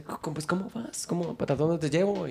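soft, lightly voiced Mexican accent; attempted Mexican accent. Taxi driver asks passenger where to go, soft attempted Mexican accent. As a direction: try a quiet Mexican cabbie. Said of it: "la voy a intentar hacer el acento".

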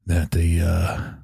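low, deadpan growl of the news; gruff low monotone husband; Witcher voice. Pretend. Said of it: "the voice I had to use".

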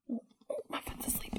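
fast whispered complaint. Real, not acted. Noticing a sleeping foot mid-recording, whispered. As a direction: whisper it quickly.

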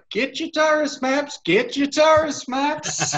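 put-on high-pitched kid hawking; kid street vendor. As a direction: call out like a little boy selling maps. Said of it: "Get a kid walking along".

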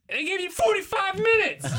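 high, loud game-show shout; laughing mock shout. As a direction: belt it out like a wild announcer.

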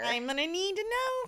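sweetly furious mom keeping it together; extra high mom voice. Pretend. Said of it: "My extra high mom voice".

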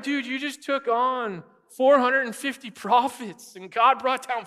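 like shaking a friend who bailed; incredulous disbelief. Retold or imitated.

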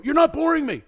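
high, loud frantic no no no; panicked protest. Retold or imitated. To her friend who thinks she's bored, panicked pleading. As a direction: protest in a panic so she won't leave. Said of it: "I go".

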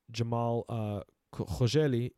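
Arabic "Khashoggi", kh from the throat; Arabic pronunciation. Real, not acted. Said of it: "I speak Arabic, so I can't say it with an English accent".

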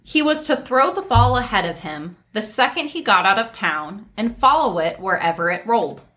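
ordinary delivery

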